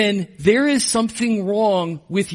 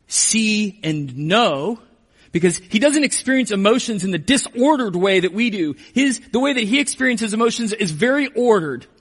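ordinary talk, nothing marked

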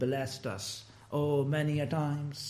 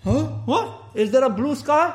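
surprised passer-by; 7 semitones higher, 5 dB louder than usual. Retold or imitated. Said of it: "grumpy man answers, surprised".

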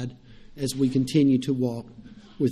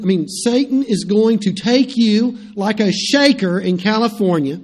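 loud, higher pulpit preaching; 6 semitones higher, 5 dB louder than usual. Acted. Pastor telling the church about Simon Peter, loud fervent preaching. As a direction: hammer the point from the pulpit.

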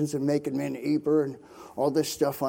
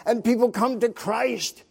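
higher, louder impassioned preaching; fervent preaching; 7 semitones higher, 4 dB louder than usual. Acted. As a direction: let the testimony swell to a climax. Preaching climax about mission fruit.

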